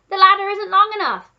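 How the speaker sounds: high, loud protesting call; gardener calling back. A narrator's reading. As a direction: call the excuse down from up a ladder. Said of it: "the gardener called back".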